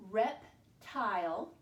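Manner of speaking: "Reptile" slowly, with a gap after "Rep"; syllable by syllable. Shown as a demonstration. Teacher works the word out with pupils, "Reptile" slowly split. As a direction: say "Reptile" as two separate words, rep... tile. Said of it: "break it down into two syllables".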